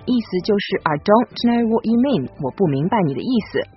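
normal talking voice

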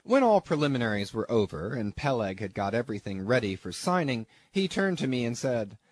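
plain reading voice

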